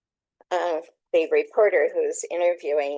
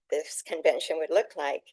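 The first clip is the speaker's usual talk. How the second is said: normal talking voice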